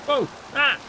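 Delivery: sudden startled yelp. Real, not acted. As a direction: jump at a scare. Sudden scare in game.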